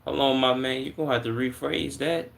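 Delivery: usual speaking voice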